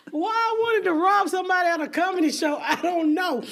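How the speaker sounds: high, loud, laughing disbelief; real laughter breaking into the words; cracking up. Real, not acted. Comic laughing at wanting to rob somebody, helplessly amused.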